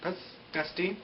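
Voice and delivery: high squeal of disgust at a gross wound; an ew that jumps high, then fades softly. Retold or imitated. Recapping the show, grossed out by the broken leg, high then soft. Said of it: "I was all".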